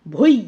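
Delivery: ভৈ alone and loud, gliding from o to i; isolated syllable demo. Shown as a demonstration. Teacher asking students to look, ভৈ pronounced emphatically alone. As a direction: punch out ভৈ as one gliding "bhoi". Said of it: "ভৈটা এখন উচ্চারণ করছি".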